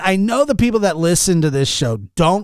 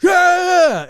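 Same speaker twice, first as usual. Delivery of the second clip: very high imitation of a yelling friend; hammed-up, high-pitched holler; retold frantic yell; 14 semitones higher, slower than usual. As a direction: mimic a fight fan losing it. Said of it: "And he's going".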